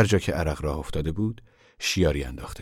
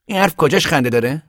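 higher, louder, irritated question; annoyed; 10 semitones higher, 7 dB louder than usual. Acted. Tom to the ragged man, annoyed and louder. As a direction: snap back, offended. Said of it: "تام با دلخوری گفت".

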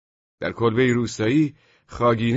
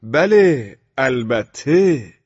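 «بله، البته» stretched slowly, raised and falling; drawn-out tone; 3 semitones higher, 6 dB louder, much slower than usual. A narrator's reading. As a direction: drag out «البته» insolently while staring him down. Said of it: "با لحنی کشیده گفتم". («بله، البته» با لحنی کشیده)

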